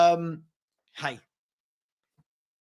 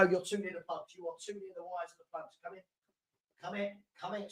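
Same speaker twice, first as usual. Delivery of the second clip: hushed aside to the dog; 16 dB quieter, faster than usual. Real, not acted. Streamer quietly coaxing his puppy off the cables, hushed. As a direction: coax the dog under your breath.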